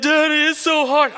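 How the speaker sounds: high, whimpering little-girl imitation; mock tearful childish complaint; crying child. Crying daughter in heart-to-heart with dad, imitated wail. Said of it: "And she's crying".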